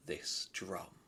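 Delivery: plain reading voice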